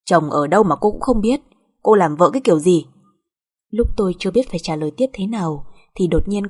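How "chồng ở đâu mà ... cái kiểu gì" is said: louder, stern reproach; scolding. Acted. Mother-in-law scolding daughter-in-law about her husband, stern. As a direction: tell her off down the phone.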